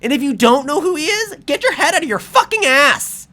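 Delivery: high, loud furious rant; real anger. Real, not acted. Vlogger ranting at the hockey players, furious and loud. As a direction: let the outrage boil over. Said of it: "But I'm angry".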